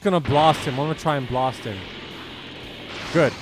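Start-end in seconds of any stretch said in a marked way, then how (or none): none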